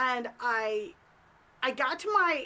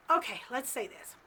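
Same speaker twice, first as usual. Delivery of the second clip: ordinary delivery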